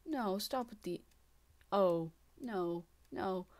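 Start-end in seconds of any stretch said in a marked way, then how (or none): none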